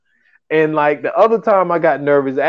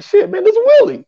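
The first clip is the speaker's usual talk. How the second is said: much higher, starstruck disbelief; awed excitement; 3 dB louder than usual. Retold or imitated. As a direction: voice jumping up, star-struck. Nervous before a big interview.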